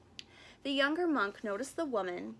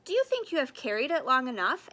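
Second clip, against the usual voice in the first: gentle question; 3 semitones higher, 9 dB louder, faster than usual. A narrator's reading. Stillwater asks Carl.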